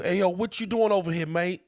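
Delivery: high, loud British street challenge; mock British accent. Co-host playing an oi boy on the roads, loud mock British accent. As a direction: call out in a jokey British accent. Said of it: "with a British accent".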